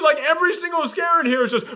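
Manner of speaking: ordinary delivery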